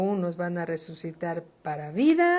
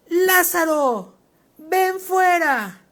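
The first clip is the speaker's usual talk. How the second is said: a loud, slow, high call; 9 semitones higher, 9 dB louder, slower than usual. A narrator's reading. Preacher as Jesus calls Lazarus out of the tomb, loud. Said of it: "Desde afuera del sepulcro gritó".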